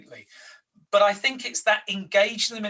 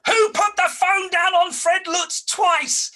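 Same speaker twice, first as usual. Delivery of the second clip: high, loud, slow furious yell; shouting boss; 13 semitones higher, 6 dB louder, slower than usual. Retold or imitated. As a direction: bellow across the office.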